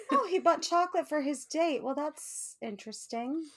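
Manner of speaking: fake-innocent remark that turns wry; sarcastic mock surprise. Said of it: "I was like".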